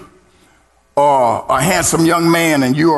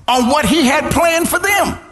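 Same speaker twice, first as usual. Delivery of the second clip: high, sung preacher's whoop over the music; preaching at full force; 7 semitones higher than usual. Acted. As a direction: lift it into a chanted gospel climax.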